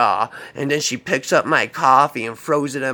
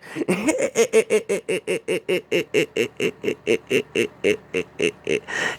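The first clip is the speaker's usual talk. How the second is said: long high stuttering cackle; crazy laugh; 4 semitones higher than usual. Retold or imitated. Demonstrating the laugh that echoes in his mind, crazy cackle. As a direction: draw out a mad, staccato cackle. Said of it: "This crazy laugh that she always did".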